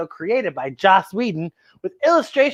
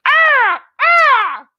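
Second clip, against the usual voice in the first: high, loud screeching bird cry; vulture screech; 6 dB louder than usual. Shown as a demonstration. Host showing kids a vulture sound, loud high screech. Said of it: "Can you do a vulture sound".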